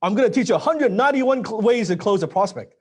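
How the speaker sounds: mock the course-selling sales trainer; hammed-up hype-man promising tricks; sales guru. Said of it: "like some of these salespeople".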